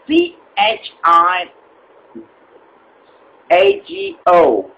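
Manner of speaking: slow, deliberate letter by letter; slow spelling. Acted. Caller spells slowly for the agent. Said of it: "spelling slowly for agent".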